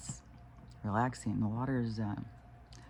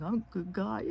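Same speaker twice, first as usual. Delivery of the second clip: put-on much higher toddler voice, earnest; little grandson; 9 semitones higher than usual. Grandson insisting to his aunts, high toddler voice. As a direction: imitate a small boy insisting he's good. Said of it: "then he'll be like".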